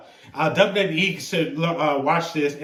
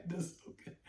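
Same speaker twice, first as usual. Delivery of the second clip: whispered through near-silent laughter; words barely out between laughs; breathless laugh; 22 dB quieter, much faster than usual. Real, not acted. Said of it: "I can't even stop laughing".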